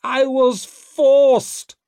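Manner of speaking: very high, loud, drawn-out wail; slow, high cry of complaint. A narrator's reading. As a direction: wail it in helpless protest. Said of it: "wailed the accused".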